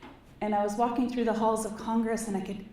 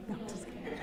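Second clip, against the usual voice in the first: whispered aside; 12 dB quieter, faster than usual. Real, not acted. Joking aside after flubbing acronym.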